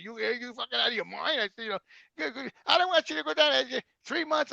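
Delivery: high, mock-upset wife voice; wife protesting. Husband retelling his wife begging him not to go, high mock-upset.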